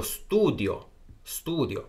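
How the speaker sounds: studio said slowly with a clear st start; teacher slowly models studio for students; model pronunciation. Shown as a demonstration. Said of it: "还有studio".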